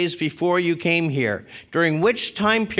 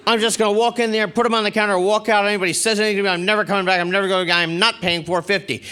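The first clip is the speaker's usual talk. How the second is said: higher, fast mock rant; imitated rant; 5 semitones higher, faster than usual. Woman complaining to the room about late fees, imitated rant. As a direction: carry on indignantly to nobody, rattling it off. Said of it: "carrying on to no one in particular".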